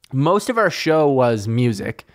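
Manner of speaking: normal talking voice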